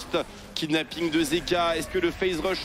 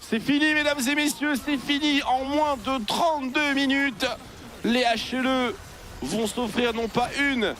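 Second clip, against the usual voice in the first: high, loud game-winning call; esports caster; 9 semitones higher, 4 dB louder, slower than usual. Real, not acted. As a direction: announce the win to the crowd. Game-ending hype call. (commentateur esport qui annonce la victoire)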